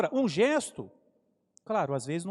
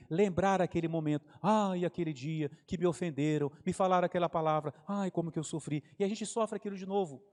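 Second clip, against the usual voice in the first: ordinary talk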